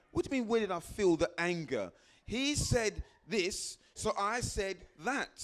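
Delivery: indignant client brushing off the question; irritated client. Retold or imitated. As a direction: push back like a defensive client. Client pushes back, irritated.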